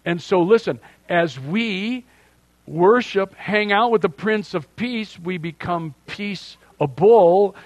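preaching at full force. Acted. Pastor urging the congregation to be peacemakers, fervent and loud. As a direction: drive it home to the congregation.

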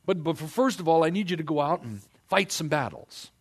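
a hint of a conniving king; voicing Saul. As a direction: slip in the catch casually. Saul voiced setting conditions.

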